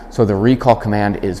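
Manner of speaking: usual speaking voice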